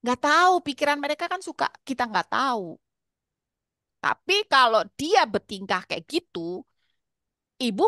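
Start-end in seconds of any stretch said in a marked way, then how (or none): none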